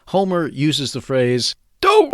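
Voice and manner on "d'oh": a sudden, much higher and louder cartoon yelp. Host jokes about the wrong Homer, mock cartoon yelp. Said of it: "no, not that [name]".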